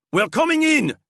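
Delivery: very high, loud shout. A narrator's reading. Guard shouts he is coming in, very high and loud. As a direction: bellow the warning through the door. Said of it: "shouted the guard".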